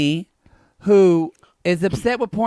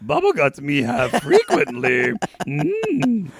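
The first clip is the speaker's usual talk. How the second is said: higher-pitched puppet-creature voice with a hum; Yoda; 6 semitones higher, slower than usual. Acted. Co-host confessing in higher Yoda voice. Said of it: "Who knew I could do Yoda".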